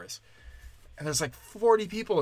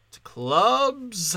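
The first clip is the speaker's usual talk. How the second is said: higher, drawn-out insistence; mock demanding; 6 semitones higher, 4 dB louder than usual. Comic turning down a hotel open mic, mock demanding. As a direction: stretch out CLUBS like a grand demand.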